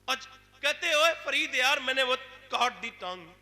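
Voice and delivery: loud, high, emotional sermon; impassioned preaching. Acted. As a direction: cry out the lesson at full force. Preaching at full force.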